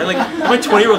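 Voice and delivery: ordinary talk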